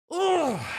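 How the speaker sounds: high shrill cry of rage; furious unicorn groan; frustrated ugh. Acted. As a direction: explode in exasperation. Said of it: "stamped her hoof in rage".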